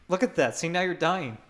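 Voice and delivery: usual speaking voice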